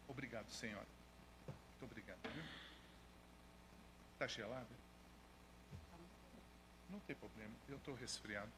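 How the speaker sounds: bem baixo e grave, quase só para si; aparte discreto. Real, not acted. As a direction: agradecer em particular, longe da congregação. Aparte a quem lhe serve água. (aparte em voz baixa)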